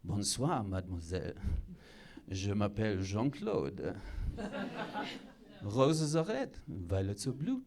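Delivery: low, soft French seducer; sexy French accent. Shown as a demonstration. Suave Frenchman greets a mademoiselle, soft French accent. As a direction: croon it softly with a French accent. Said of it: "Like a French accent".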